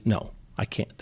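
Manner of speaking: muttered refusal. Retold or imitated. Guest voicing a threatened husband refusing his wife, whispered. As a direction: shut her out under your breath.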